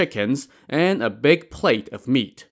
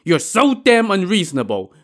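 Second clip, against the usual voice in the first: higher, louder indignant yell; angry outburst; angry shout; 7 semitones higher, 4 dB louder than usual. A narrator's reading. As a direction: bark it with eyes glaring wide. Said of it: "Wu Song shouted".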